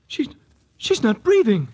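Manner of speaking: a niece discovering her aunt won't wake; frightened realisation. Acted. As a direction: blurt it out as the horror sinks in.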